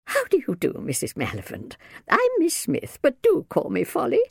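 very high-pitched genteel greeting; fluttery aunt, friendly. A narrator's reading. Aunt Folly meets the minder, eager to please. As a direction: introduce yourself in a high, fluttery voice.

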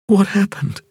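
dazed wonder at meeting him; young woman Ping. A narrator's reading. Ping confused.

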